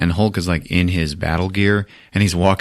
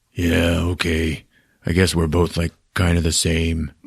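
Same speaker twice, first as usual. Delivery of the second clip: subdued Hulk; 4 semitones lower, 4 dB quieter than usual. Retold or imitated. Hulk making up with Thor, low and subdued. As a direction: lightly voice a mollified Hulk.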